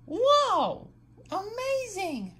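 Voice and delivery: loud, high gasp of wonder; be blown away; amazed whoa. Acted. Amazed at kite trick.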